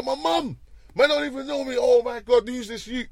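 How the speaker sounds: high, loud retold outrage; getting mad. Venting alone in the cell about the insult, heated outrage. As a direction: replay the fury at the insult to his mum. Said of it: "I got mad in the cell".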